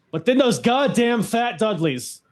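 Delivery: mock outrage. Villains arrive, mock outrage.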